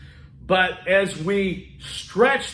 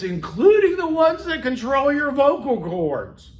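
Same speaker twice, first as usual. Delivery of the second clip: high, slow, strained demonstration; exaggerated high-pitched tension; tensed-up voice; 7 semitones higher, 3 dB louder than usual. Shown as a demonstration. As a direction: tighten the throat and drive the pitch up. Demonstrates tensed vocal cords raising pitch.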